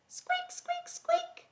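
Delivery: tiny, very high squeaks; mouse squeaks. Pretend. Storyteller plays the little mouse for young listeners, high squeaking.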